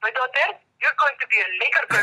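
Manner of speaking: imitating dad's heavy Filipino accent; fatherly line in a thick Filipino accent. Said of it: "with his heavy Filipino accent said".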